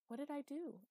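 high alarmed question. Acted. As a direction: ask in sudden alarm. Magda alarmed after mistake.

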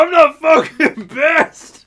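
very high, drawn-out mock bellow; slow hammed-up yell; imitated furious shout. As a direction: act out an outraged drunk yelling a denial. Retold drunk customer's furious denial.

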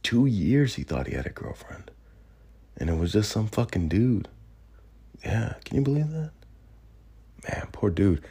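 under his breath. Retold or imitated. Bystanders gossiping about Manti under their breath, hushed. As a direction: mutter it like gossip nobody should overhear. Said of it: "under his breath".

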